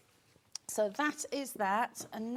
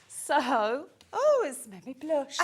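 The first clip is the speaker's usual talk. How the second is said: laughing; 8 semitones higher, 9 dB louder, slower than usual. Real, not acted. Giggly over fox joke.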